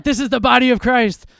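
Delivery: hammed-up priest giving communion; mock priest. Priest offering the wafer, hammed-up solemn voice. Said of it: "the priest is like".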